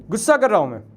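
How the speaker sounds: short angry burst. Real, not acted. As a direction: call it out angrily, falling at the end. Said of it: "मुझे थोड़ा गुस्सा आ गया".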